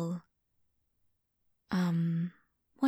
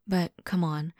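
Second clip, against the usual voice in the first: ordinary delivery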